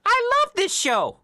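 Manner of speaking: very high silly robot voice. Retold or imitated. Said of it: "his robot walks in and goes".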